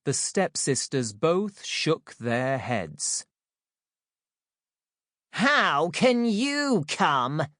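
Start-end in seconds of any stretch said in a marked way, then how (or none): 5.3-7.5 high, slow mocking stepsister; screech. A narrator's reading. First stepsister screeches scornfully at Cinderella. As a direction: sneer it out as the cruel sister. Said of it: "screeched the first stepsister".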